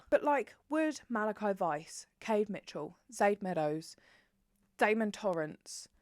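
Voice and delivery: normal talking voice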